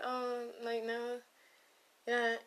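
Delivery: half-hearted reassurance. Retold or imitated. Trying to console a self-deprecating texter, mock reassuring. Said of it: "you try to be like".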